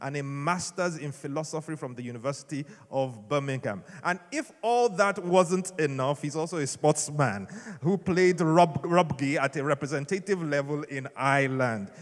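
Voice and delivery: usual speaking voice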